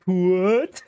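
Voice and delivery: loud laughing "what"; real startled amusement; surprised laugh. Real, not acted. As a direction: gamer caught off guard by the twist. Gamer reacts with a laugh.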